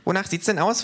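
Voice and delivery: high, cheeky kangaroo voice. Acted. Kangaroo teases the neo-Nazis, high and cheeky. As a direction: needle them with a grin.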